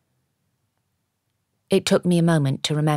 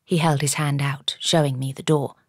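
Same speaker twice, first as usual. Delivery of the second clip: plain reading voice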